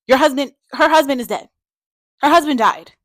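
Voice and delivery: loud, high, emphatic reproach; indignant scolding. Acted. Reviewer reminding a character of a widow's loss, indignant. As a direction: drive the fact home, loud and high.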